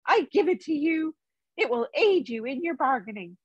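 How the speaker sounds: a polite hobbit bargaining with the Elvenking; Bilbo the hobbit. A narrator's reading.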